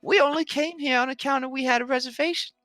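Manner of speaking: high, upset husband voice; a worried, whiny husband pleading innocence. Acted. GM voicing NPC partner.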